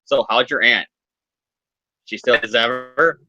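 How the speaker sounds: low, understated creepy deadpan; creepy guy. Acted. Disguised villain asking Peter about his aunt, creepy low deadpan. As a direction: drop deep and awkwardly change the subject. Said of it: "it gets all creepy as the guy says".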